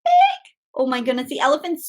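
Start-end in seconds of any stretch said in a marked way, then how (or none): 0.0-0.5 big said high and loud; stress on big. Acted. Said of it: "So big".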